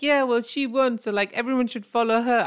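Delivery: high, loud mock Canadian accent. Mocking Canadians on a podcast, high mock Canadian accent. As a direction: send up a cheery Canadian voice. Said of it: "I can't do my Cana-, Canadian accent too long".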